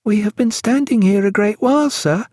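high-pitched character voice for Sarah; high, even-toned storybook character; Sarah's voice. A narrator's reading. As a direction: speak up high, well above the narration.

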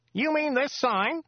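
high, chatty local driver voice; chatty driver Joe. Acted. Driver Joe chatting to Dirk on the drive, high chatty voice. Joe's voice.

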